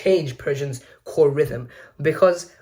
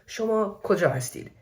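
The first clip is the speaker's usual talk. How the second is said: "Shoma koja hastid" lifted high as a gentle question; higher-pitched Persian question tune on "Shoma koja hastid"; gentle rising intonation; 6 semitones higher than usual. Shown as a demonstration. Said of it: "gentle rising intonation in questions".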